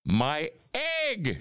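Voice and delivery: high, astonished cry without much volume; Horton shouting. A narrator's reading. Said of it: "shouted Horton".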